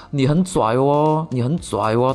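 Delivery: put-on Shenzhen kid Mandarin with Cantonese twang, slow; lightly imitating a Cantonese accent in Mandarin. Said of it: "他就用那种有点广东口音".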